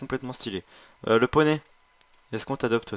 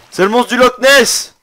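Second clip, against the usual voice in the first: excited shout; 12 semitones higher, 16 dB louder than usual. Real, not acted. Spots the boss, yells.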